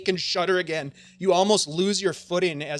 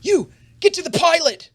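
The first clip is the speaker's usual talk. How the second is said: loud, high-pitched commanding shout; shouted order; 12 semitones higher, 9 dB louder than usual. Acted. McCready shouting orders as they race in, urgent shout. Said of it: "McCready starts shouting out".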